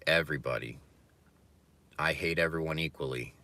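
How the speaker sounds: normal talking voice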